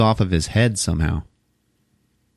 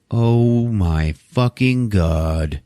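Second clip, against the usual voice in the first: very slow, drawn-out disbelief; stunned horror; much slower than usual. Retold or imitated. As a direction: stretch out every word, aghast. Woman sees killer, retold.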